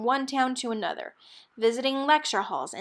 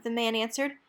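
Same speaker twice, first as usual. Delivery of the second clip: ordinary delivery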